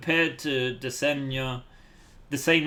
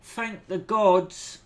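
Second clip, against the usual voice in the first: higher, slow, overjoyed relief; relieved commoner; 9 semitones higher, 7 dB louder than usual. Acted. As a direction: gush with disbelieving relief. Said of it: "the other one is like".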